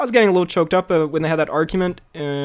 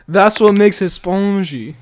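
put-on slow, higher, louder French accent; mock French accent; 4 semitones higher, 6 dB louder, slower than usual. Repeating Frenchie's line back to the co-host, mock French accent. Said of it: "most pivotal Frenchie scene".

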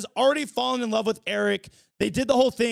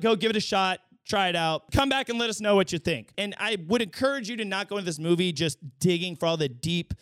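ordinary talk, nothing marked

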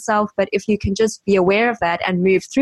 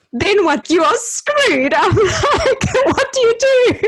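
high, louder, breaking into laughter; laughing dismay; 13 semitones higher, 3 dB louder, slower than usual. Retold or imitated. Runner retells her worries to her host, laughing dismay. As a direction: laugh helplessly through a mock-desperate question. Mock panic over miserable running conditions.

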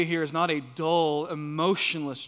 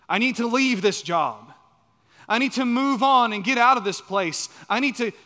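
raised, louder voice of someone wanting out; acted desperate complaint; 7 semitones higher, 8 dB louder than usual. Preacher voicing someone who wants out of trials, acted desperation. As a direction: press each need urgently, high and loud.

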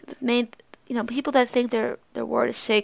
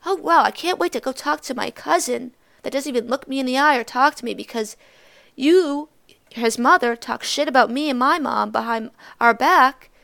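ordinary delivery

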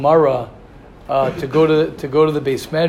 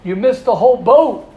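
raised, louder self-reproach; worked up; 5 semitones higher, 5 dB louder than usual. Retold or imitated. Teacher retells scolding himself over a lost Gemara, worked up. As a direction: exclaim it at yourself, higher and punchy. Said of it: "I'm getting all worked up, and I'm like".

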